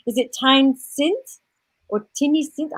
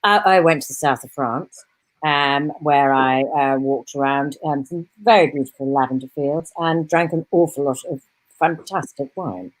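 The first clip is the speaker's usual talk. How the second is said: lower, measured consultant surgeon; cool, guarded senior doctor; Serena Campbell; 8 semitones lower than usual. Acted. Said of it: "while you get into character".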